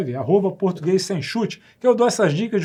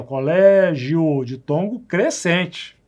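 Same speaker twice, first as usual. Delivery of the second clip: slow demo; much slower than usual. Shown as a demonstration. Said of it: "aqui sim eu tenho de tom crescente".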